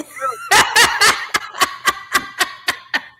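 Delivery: high, loud burst of laughter. Real, not acted. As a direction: crack up long and high. Cracking up after the remark.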